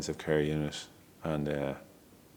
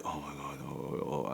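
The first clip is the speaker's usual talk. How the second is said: soft, under the breath, quieter; hushed dismay; 4 semitones lower, 14 dB quieter than usual. Retold or imitated. The staff stopped by his words, murmuring in hushed dismay. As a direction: mutter it like you're taken aback.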